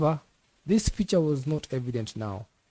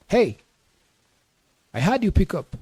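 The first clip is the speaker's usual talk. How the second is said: irritated caller; 7 semitones higher, 4 dB louder than usual. A narrator's reading. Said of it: "already irritated".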